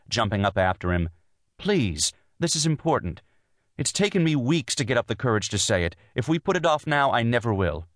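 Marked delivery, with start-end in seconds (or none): 1.6-7.9 high, urgent pleading; protesting. A narrator's reading. Wife stops husband leaving for the office, protesting. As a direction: plead with him to hear you out. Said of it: "she protested".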